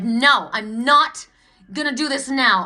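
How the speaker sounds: slow, loud, stubborn no; slow furious refusal; angry refusal. Retold or imitated. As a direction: drag it out, refusing hard. Retelling refusal before slamming door.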